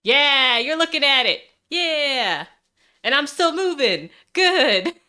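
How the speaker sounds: high and loud with delight; thrilled it works, bursting out; excited. Real, not acted. Model debut works live.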